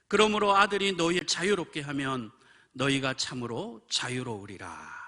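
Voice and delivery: loud, sustained recitation slowing at the close; declaimed Bible verse; scripture proclamation. Acted. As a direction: proclaim the verse, then stretch out the final words.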